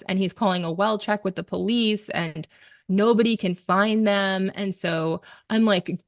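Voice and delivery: ordinary talk, nothing marked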